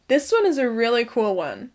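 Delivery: normal talking voice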